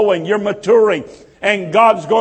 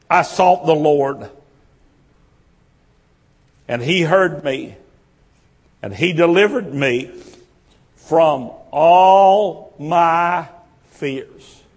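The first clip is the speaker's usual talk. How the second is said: scripture reading; 4 semitones lower than usual. Acted. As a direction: let each phrase of the verse hang. Reads verse four slowly.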